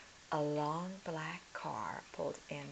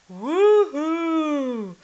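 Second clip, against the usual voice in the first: long loud high spooky woo; haunt them with a wail; ghostly wail; 11 semitones higher, 17 dB louder than usual. Acted. Ghost moaning.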